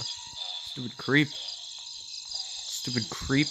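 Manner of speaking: slow, drawn-out whispered taunt; wicked stepmother. Acted. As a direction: sneer it out slowly, fading to a whisper.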